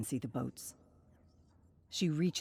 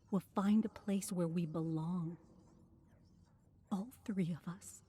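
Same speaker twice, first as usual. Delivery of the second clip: tender dreaming; 5 semitones higher than usual. Acted. Sister promises her dying brother a free life, tender and hushed. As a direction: paint the dream gently for him.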